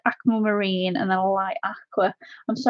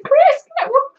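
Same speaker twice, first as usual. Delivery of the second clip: high, loud wake-up call; excited calling out; 5 dB louder than usual. Retold or imitated. Streamer imagining waking Chris with news, excited calling out. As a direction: shout to rouse someone. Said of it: "I'll be running off here in a bit going".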